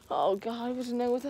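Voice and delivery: quiet, scared murmur; a frightened hush for a friend. Real, not acted. Vlogger alone wishing Nick were there, scared hush. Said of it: "protect me or something".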